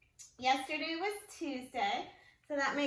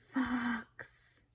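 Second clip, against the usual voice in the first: ordinary delivery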